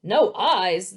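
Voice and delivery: loud, outraged question; booming. A narrator's reading. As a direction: boom it out in disbelief. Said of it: "the magistrate boomed".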